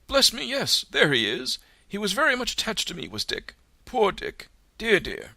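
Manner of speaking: high, quick, fond reminiscing; fond old Scrooge. A narrator's reading. Scrooge telling the ghost of Dick, high and fond. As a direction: gush over an old friend.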